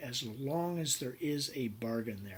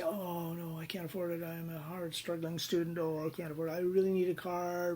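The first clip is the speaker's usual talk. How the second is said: lightly higher, pleading mock student voice; pleading struggling student; 5 semitones higher than usual. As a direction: whine a little about being broke. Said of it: "You say".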